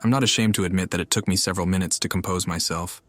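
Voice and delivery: usual speaking voice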